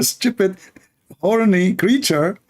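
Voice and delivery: ordinary talk, nothing marked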